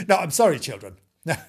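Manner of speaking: plain reading voice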